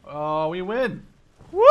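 loud happy whoop at the win; cheering win. Real, not acted. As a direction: celebrate the victory out loud. Winning the match, cheering.

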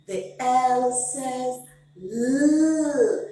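The L says L with L drawn out into a long luu; slow letter sound. Shown as a demonstration. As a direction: stretch the sound of "L" slowly, as in luu. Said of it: "Every letter makes a sound".

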